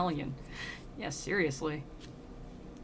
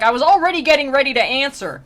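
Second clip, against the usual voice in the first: loud, higher-pitched mock retort; exasperated; 6 semitones higher, 11 dB louder than usual. Dante protests he was about to reply, exasperated. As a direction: play someone loudly fed up with prompting.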